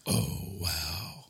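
low, soft awestruck mimicry; Sniper. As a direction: imitate a friend's hushed, deep amazement. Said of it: "Exactly in that voice".

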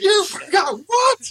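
high, slow outraged yell; shocked shout. Real, not acted. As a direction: scream it in disbelief. Outraged at the forgotten recording.

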